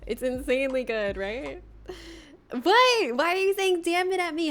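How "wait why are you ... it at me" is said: playful indignation. Real, not acted. Mock offence at chat.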